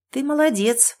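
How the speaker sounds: ordinary delivery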